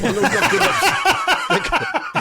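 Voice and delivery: drawn out high and slow through a laugh; laughing drawl. Real, not acted. Laughing back at his mate's teasing, slow and high. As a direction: laugh it out, stretching every word.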